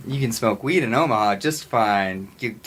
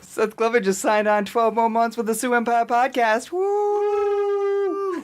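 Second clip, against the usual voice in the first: hype announcement; 13 semitones higher, slower than usual. Real, not acted. Cohost announces himself, hyping.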